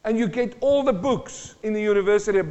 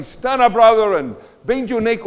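mock faith healer; 3 semitones higher, 6 dB louder than usual. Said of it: "they will say".

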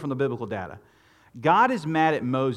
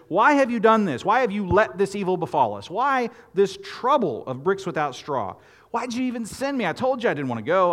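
higher, louder anguished complaint to God; frustrated Moses; 6 semitones higher, 4 dB louder than usual. Retold or imitated. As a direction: cry out at God like a let-down prophet. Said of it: "Moses turns to the Lord and says".

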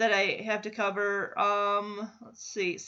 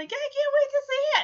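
high, fast mock squeal; mock gush, much higher; imitating past excitement; 7 dB louder, faster than usual. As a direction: replay the giddy hope. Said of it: "I was so excited originally".

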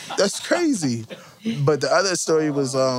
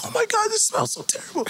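high, fast mock-disgusted squeal; disgusted friend; faster than usual. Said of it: "He was like".

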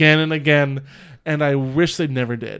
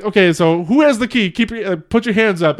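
louder, higher robber's orders; hammed-up raised-voice demand; mock yelling; 5 semitones higher, 6 dB louder than usual. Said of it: "He starts, like, yelling".

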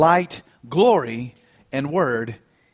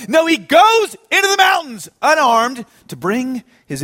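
higher-pitched, emphatic sermon delivery; impassioned preaching; 5 semitones higher than usual. Real, not acted. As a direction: preach it with mounting force. Preaches with rising force.

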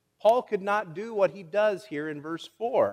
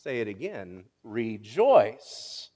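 gentle insistence; 9 semitones lower, much slower than usual. Retold or imitated. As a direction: calmly press the point home. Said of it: "And he said".

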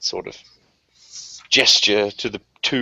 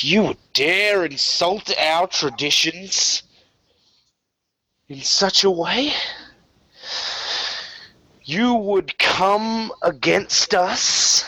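higher, slow, outraged questioning; furious disbelief; 6 semitones higher than usual. Acted. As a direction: drag out each accusation in offended fury. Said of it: "confused and kind of furious".

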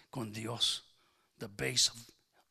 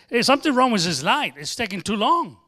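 loud, higher complaining call; mock complaint to police; 6 semitones higher, 15 dB louder than usual. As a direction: complain loudly to the police station. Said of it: "y decirle".

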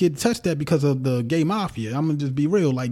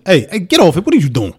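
firm call of hey, a bit louder; stern dad correction; 5 dB louder than usual. Retold or imitated. Father correcting his son, firm louder call. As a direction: stop the kid like a father would. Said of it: "I'll be like".

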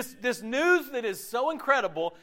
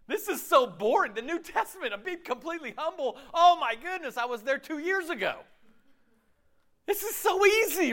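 smug mock boasting; 7 semitones higher than usual. Mocking a proud churchgoer.

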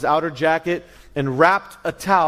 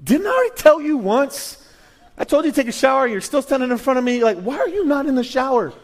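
exasperated parent; 6 semitones higher than usual. Retold or imitated. Preacher voicing himself as a father scolding his kids, exasperated. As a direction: scold a dawdling kid about the shower. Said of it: "I have kids, this look".

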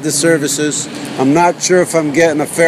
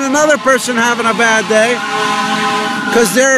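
mock-upbeat countdown announcer, pitched up; put-on happy; 6 semitones higher than usual. Podcaster going happy for the rest of the show, put-on cheerful. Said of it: "I'm gonna go happy".

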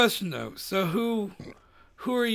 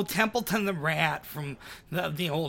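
Templeton the Rat; 5 dB quieter than usual. Shown as a demonstration. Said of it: "he talks like this".

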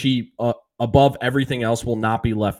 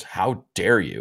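mock-offended, slow "how dare you", slightly lower; retold indignation; 3 semitones lower, 3 dB quieter, slower than usual. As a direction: act affronted, drawing out each word. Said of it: "He goes".

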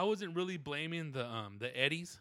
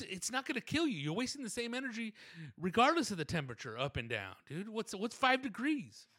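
much higher, exasperated pleading; a mock rant, pitched up high; retold rant; 6 semitones higher than usual. Customer ranting at the tax lady about the AC, mock exasperated.

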